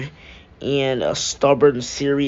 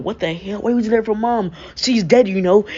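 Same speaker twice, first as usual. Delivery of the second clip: higher, agitated rush of words; freaking out; 7 semitones higher, faster than usual. Acted. Son confronting his undead father, freaking out. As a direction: panic as it all spills out. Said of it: "he starts freaking out".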